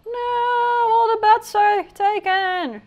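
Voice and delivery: very high, loud, slow wail; dismayed cry. Real, not acted. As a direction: wail in mock despair. Dismay at full beds.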